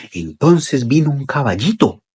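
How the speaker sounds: usual speaking voice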